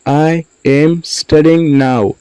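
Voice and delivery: slow, separated words for learners. Shown as a demonstration. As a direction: say "I am studying now" slowly, words apart. Teacher repeats word by word.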